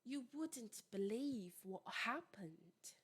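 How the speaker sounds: slow, soft, whispery British accent; British WOULDN'T with crisp D and T, drawn out slowly; British pronunciation. Shown as a demonstration. Teacher demonstrating the example to students, British accent. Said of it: "a pronúncia mais britânica".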